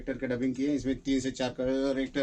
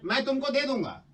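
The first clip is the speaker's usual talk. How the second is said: high, emphatic movie-dialogue delivery; acted threat demo; 9 semitones higher than usual. Shown as a demonstration. Director showing actor the line, acted with feeling. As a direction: show the feeling, hit दे hard. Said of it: "तब feeling आएगी".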